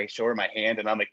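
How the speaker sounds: usual speaking voice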